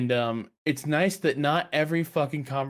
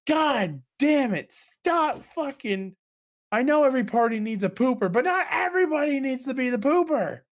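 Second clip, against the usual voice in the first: exasperated outburst; 11 semitones higher than usual. Retold or imitated. Player fed up with a brooding character, exasperated. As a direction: vent at the gloomy party member, hammed up. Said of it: "And I'm just like".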